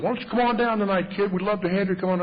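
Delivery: high, fast, pushy welcome; eager invitation. Retold or imitated. AA man on the phone coaxing a newcomer, imitated eagerness.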